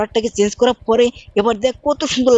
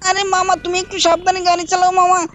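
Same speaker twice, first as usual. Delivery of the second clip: put-on high, pleading little-boy voice; Shinchan child voice; 8 semitones higher than usual. Shinchan nagging his uncle, high childish voice. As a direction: pitch way up and whine at mama like a small kid.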